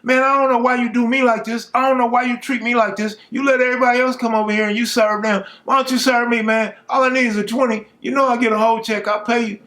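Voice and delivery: high, loud, fast whining plea; yelling and fussing. Retold or imitated. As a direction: fuss and beg at full pitch. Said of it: "now he's yelling and fussing".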